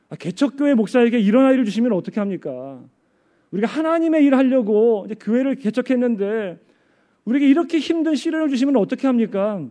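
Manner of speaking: loud, high protest to God; reproachful prayer. Retold or imitated. Pastor's wife at dawn prayer over the child, bitterly reproachful. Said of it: "원망을 많이 했대요".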